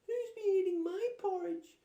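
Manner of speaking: very high, loud little bear cry; crying Baby Bear. A narrator's reading. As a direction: squeal it like a tiny upset cub. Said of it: "cried Baby Bear".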